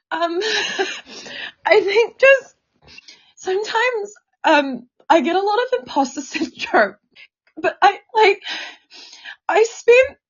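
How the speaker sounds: halting, choked-up real tears; tearful, breaking off to recover; crying. Real, not acted. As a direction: squeeze words out between sobs. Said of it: "I'm fucking bawling".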